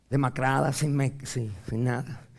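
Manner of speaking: normal talking voice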